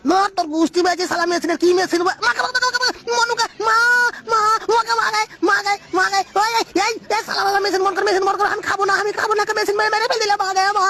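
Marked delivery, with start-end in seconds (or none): 2.3-10.9 high, frantic cries for mother; panicked yelling. Acted. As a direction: scream for help as it overwhelms you. Machine starts again, pleading.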